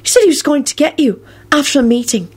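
high-pitched, puzzled explanation; bewildered Luna. A narrator's reading. Luna translates Harry's threat for Neville, bewildered. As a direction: explain as if baffled. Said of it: "in a bewildered voice".

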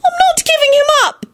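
very high, tearful wail; distraught wail. A narrator's reading. Elizabeth refusing the midwife, very high wail. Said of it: "Elizabeth suddenly wailed".

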